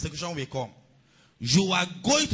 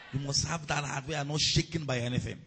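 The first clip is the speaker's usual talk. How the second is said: ordinary talk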